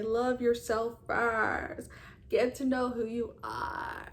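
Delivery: slow, stretched-out preachy mimicry; mocking imitation. Vlogger mimicking self-love people she hated, mocking. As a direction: drag out the last words in mockery.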